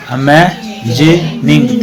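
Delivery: अमेजिनिंग slowly split as "ama-jee-ning"; three separate syllables. Shown as a demonstration. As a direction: break अमेजिनिंग into three slow chunks. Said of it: "तीन syllable है". (अमेजिनिंग को तीन हिस्सों में तोड़कर)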